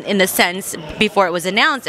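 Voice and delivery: ordinary talk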